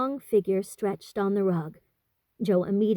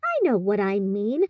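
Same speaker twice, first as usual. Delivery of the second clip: usual speaking voice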